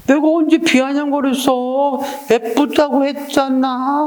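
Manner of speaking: high, slow mock-innocent protest; teacher playing Suni denying the jab, high and slow with fake innocence; feigned innocence. Drawn-out pretend-hurt denial. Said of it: "뭐, 이렇게 할 수 있지".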